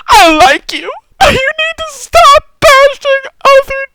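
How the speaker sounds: high, loud, slow mocking liberal. Mocking a commenter who scolds him, high and prissy.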